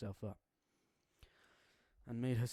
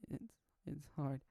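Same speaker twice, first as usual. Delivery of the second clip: sobbing silently; 5 semitones higher, slower than usual. Acted. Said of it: "She sobbed silently".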